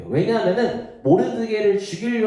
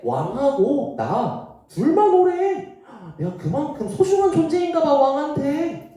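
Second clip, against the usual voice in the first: boastful Haman; 11 semitones higher than usual. Acted. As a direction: crow about being the king's favourite. Haman boasts to friends and wife. (높고 느리게 웃으며 자랑하는 하만)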